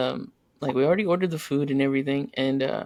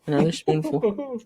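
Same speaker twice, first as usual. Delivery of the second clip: teasing; 3 semitones higher, 3 dB louder than usual. Retold or imitated. Teasing angry wife with food.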